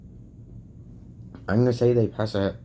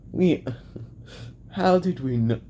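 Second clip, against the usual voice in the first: higher voice coming out of a laugh; 4 semitones higher than usual. Acted. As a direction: start high, as if just done laughing. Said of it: "a laugh and go like".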